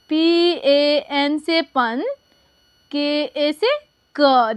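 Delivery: slow letter by letter; patient teacher spelling. Shown as a demonstration. Teacher slowly spells a name for pupils. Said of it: "तो देखिए".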